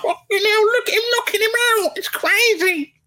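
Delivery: high, fast, giddy fan voice; mock-excited high gushing. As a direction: gush like a thrilled fan. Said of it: "One minute I'm like".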